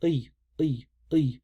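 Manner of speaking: E, E pulled back into the throat. Shown as a demonstration. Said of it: "in the back of your throat".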